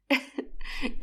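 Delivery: soft unvoiced burst of laughter; breathy laugh. Mother laughs at her daughter's messy kitchen look. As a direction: laugh quietly at the sight. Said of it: "شكلها يضحك".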